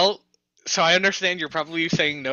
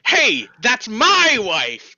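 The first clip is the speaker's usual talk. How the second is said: loud, drawn-out mock outburst; hammed-up possessive shout; loud proclamation; 3 semitones higher, 5 dB louder, much slower than usual. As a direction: proclaim it loudly, stretching the words. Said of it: "loudly proclaim".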